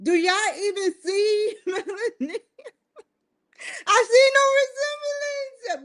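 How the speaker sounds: high, squealing laughter through the words; cracking up. Real, not acted. Vlogger showing viewers a baffling old picture, helpless squeaky laughter. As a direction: laugh so hard the voice shoots way up.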